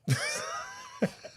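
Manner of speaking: high, screaming laughter; shrieking laugh. Retold or imitated. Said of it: "the camera's like".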